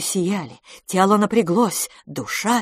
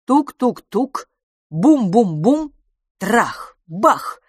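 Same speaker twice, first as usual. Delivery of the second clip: loud, drawn-out knocks growing into bangs; imitated pounding; 5 semitones higher, 6 dB louder, much slower than usual. A narrator's reading. Narrator imitates someone hammering on the door, forceful.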